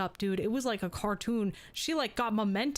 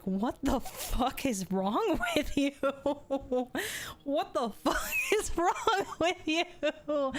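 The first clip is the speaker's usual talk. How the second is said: laughing disbelief; 5 semitones higher, slower than usual. Retold or imitated. Retold reaction to a slap.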